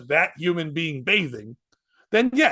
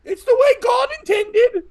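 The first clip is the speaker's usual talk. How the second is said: high-pitched shout; 6 dB louder than usual. Acted. Yelling back from the bath.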